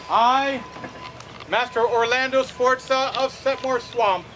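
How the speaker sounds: ordinary talk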